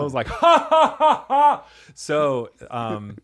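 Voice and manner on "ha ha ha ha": high, loud pretend cackle; imitated baby cackling in the womb; mock cackle. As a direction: punch out loud staccato fake ha-has. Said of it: "Oh my God, he's cackling".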